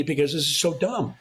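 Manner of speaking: normal talking voice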